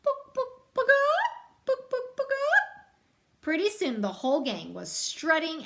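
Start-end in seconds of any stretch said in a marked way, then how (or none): 0.0-2.9 high, loud mock chicken clucks; clucking like a chicken. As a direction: cluck and crow to taunt him.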